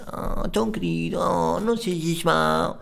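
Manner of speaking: put-on high, slow babyish whine; cutesy girl voice. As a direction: plead sweetly like a cutesy girl. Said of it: "as fofis que falam assim". (voz de fofinha, aguda e lenta)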